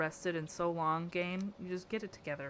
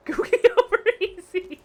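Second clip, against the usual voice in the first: very high, loud laughing voice; laughing squeal; 13 semitones higher, 10 dB louder than usual. Real, not acted. Streamer cracking up at egg slang, laughing squeal.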